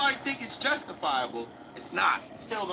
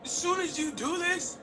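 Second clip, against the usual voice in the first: much higher, gushing fan voice; high squealing imitation of hyped chat; mock excited fans; 10 semitones higher than usual. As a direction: ham up the thrilled viewers.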